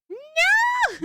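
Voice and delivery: squealed high and loud no. Pretend. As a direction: shriek it in mock protest. Mock protest.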